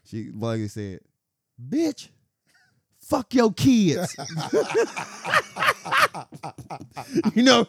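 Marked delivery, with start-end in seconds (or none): 1.7-4.2 high, slow, drawn-out cussing; imitating a drunk woman's wild retort, laughing; mock outburst. Retold to a friend, the drunk lady's high laughing outburst. 4.2-7.3 squealing laughter, breaking up; can't hold it in, high laugh; high laughing fit. Real, not acted. Telling the story to a friend, high cackling laughter.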